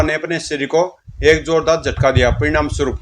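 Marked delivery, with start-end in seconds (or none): none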